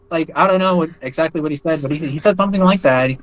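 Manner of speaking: normal talking voice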